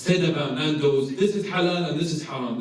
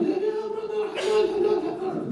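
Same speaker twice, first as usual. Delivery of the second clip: put-on very high-pitched Pakistani accent; high-pitched imitation of a Pakistani takeaway owner. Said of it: "doing a Pakistani accent".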